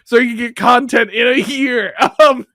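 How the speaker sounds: laughing. Real, not acted. Cracks up at her own joke.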